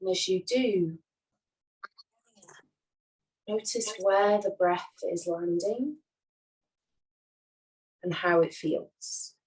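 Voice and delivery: unhurried, soothing yoga nidra voice; guided meditation. Acted. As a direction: guide them gently into rest, leaving long silences. Leads a guided relaxation.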